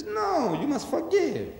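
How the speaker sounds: a preacher voicing Jesus, high and urgent; Jesus pleading. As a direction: voice Jesus insisting on forgiveness. Jesus voiced preaching forgiveness.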